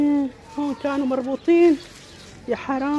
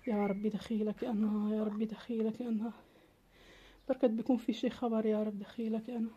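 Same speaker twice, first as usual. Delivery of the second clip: whispered prayer; 6 semitones lower, 16 dB quieter than usual. Acted. Mother praying alone for the missing kids, hushed and pleading. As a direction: beg God quietly, barely voiced. Desperate prayer waiting for news.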